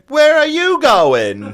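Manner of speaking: very high, loud, drawn-out screech; mock scolding woman. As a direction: screech it slowly like an outraged stranger. Imitates angry woman in parking lot.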